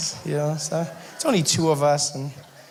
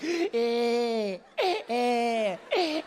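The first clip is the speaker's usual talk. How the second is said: mock toddler whining; 6 semitones higher, 5 dB quieter, much slower than usual. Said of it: "sounds like every single trap song".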